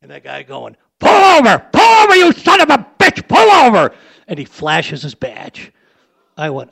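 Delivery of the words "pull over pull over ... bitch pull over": loud high furious yell; cop yelling. Retold or imitated. Cop chasing the limo yells at the driver, loud high shout. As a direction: bellow like an angry cop.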